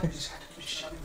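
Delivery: whispered aside; whispering. Real, not acted. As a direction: breathe it out unvoiced. Aside about the creature.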